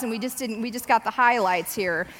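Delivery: ordinary delivery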